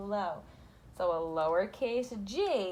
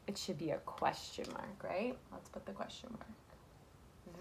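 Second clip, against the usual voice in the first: whisper; 9 dB quieter, slower than usual. Real, not acted. Teacher hushed while writing mark.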